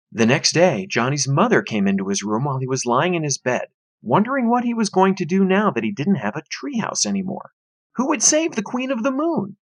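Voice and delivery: ordinary delivery, nothing marked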